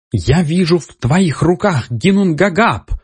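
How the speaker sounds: high squeaky exclamation. A narrator's reading. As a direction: squeal it like a tiny girl. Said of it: "воскликнула невысокая фигура писклявым голосом". (писклявый голос)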